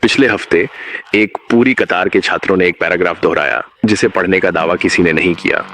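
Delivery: ordinary delivery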